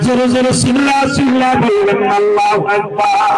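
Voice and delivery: loud call with a drawn-out finish; rally the crowd into the takbir; rousing congregational call. Real, not acted. Urging congregation to shout takbir. (জোরালো তাকবিরের হাঁক)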